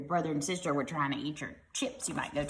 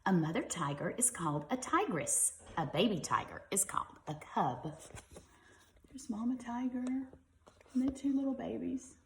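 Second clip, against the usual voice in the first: put-on reading to children; 5 semitones higher than usual. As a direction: read gently to little ones. Reads picture book to children.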